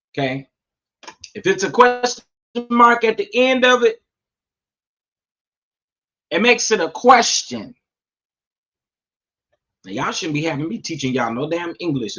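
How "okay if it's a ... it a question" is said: higher, slow, spelling it out like a teacher to slow students; condescending explanation. Shown as a demonstration. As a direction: explain it like talking to a child, with attitude. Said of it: "I have to say that for you slow asses in the back".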